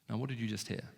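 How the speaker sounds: ordinary delivery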